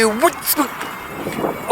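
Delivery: "Wittersk-" broken off before the last syllable; guessed pronunciation. Shown as a demonstration. Cyclist trying another town-name guess, tentative cut-off "Wittersk-". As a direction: try "Wittersk-" slowly, then abandon it. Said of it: "maybe they will pronounce it".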